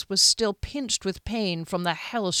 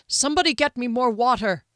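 raised, higher voice calling for help; pressing, louder demand; urgent call; 4 semitones higher, 5 dB louder than usual. Acted. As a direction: call across the room for water. Healer calling for water in emergency.

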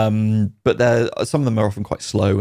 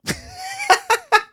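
laughing. Real, not acted. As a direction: burst of real laughter. Laughs at being asked if fun.

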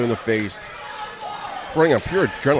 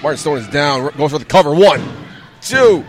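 live ringside play-by-play; wrestling commentator; 3 dB louder than usual. Acted. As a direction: call the match as it happens.

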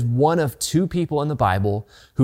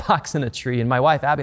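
usual speaking voice

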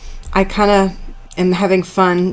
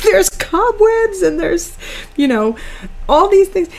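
mock crying wail; 12 semitones higher than usual. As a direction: cry out at the mess like a wounded parent. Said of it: "And I'm like".

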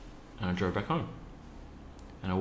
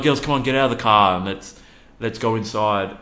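put-on calling to kids; 2 semitones higher, 7 dB louder than usual. Dad telling daughters to get out of the car, playful call.

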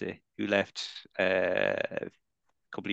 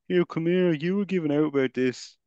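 put-on semi-Dublin accent. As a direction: confront someone in a put-on half-Dublin accent. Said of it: "it was a semi-Dublin accent".

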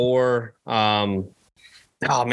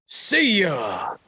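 higher, drawn home-run send-off; Michael Kay catchphrase; 9 semitones higher than usual. Retold or imitated. Mimicking the announcer's home-run catchphrase, pitched higher. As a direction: toss it off like the announcer. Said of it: "What's his catchphrase?".

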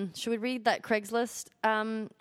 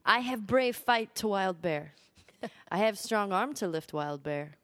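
put-on Korean accent; 2 semitones lower than usual. Co-host reading a job seeker's letter, mock Korean accent. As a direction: read it out in an attempted Korean accent. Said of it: "I can't do a Korean accent".

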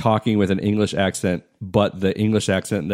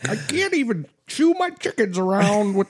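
hammed-up character voice. Comedian clowning about chickens and a hedgehog, hammed-up high voice. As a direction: play a silly high-pitched character, stretching the words. Opening mock character voice bit.

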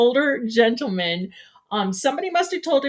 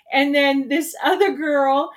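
ordinary talk

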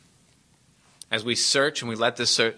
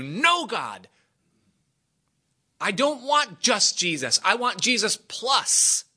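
high-pitched, insistent protest; acted childlike refusal; defiant pleading; 8 semitones higher, slower than usual. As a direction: push back petulantly, voice raised high. Acts out refusing God's answer.

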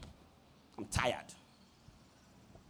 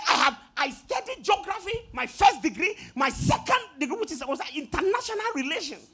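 indignant shout; 7 semitones higher than usual. Retold or imitated. Rider boasts of degrees.

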